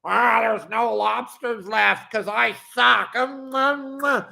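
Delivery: slow, whiny mock voice with dumb ums; captain mocking a bad fisherman's excuse post; mocking whiner. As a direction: play a clueless whiner. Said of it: "and say".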